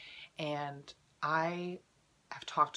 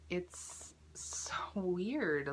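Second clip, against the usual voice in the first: whispered; 3 semitones higher, much slower than usual. Real, not acted.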